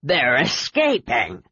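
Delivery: very slow, drawn-out dragon voice; the red dragon raging at Sky; furious dragon roar. A narrator's reading. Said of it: "the red roared furiously".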